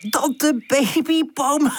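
high, drawn-out silly giggle; giggling. A narrator's reading. Woezel joking with Charlie about Tante, giggling. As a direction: stretch it high and burst into giggles. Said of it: "Woezel giechelt".

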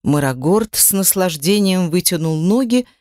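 plain reading voice

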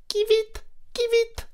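very high chirped swallow call; bird cry. A narrator's reading. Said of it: "entendit-elle crier". (cri d'hirondelle très aigu)

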